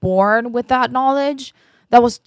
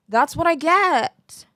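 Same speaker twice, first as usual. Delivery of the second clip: high, drawn-out mock lament; playful self-blame; 7 semitones higher than usual. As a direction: stretch it slowly in singsong resignation.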